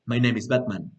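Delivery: low, serious hero reply; Batman. Acted. Teacher acting Batman replying to Spider-Man, low and serious. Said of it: "el personaje muy serio".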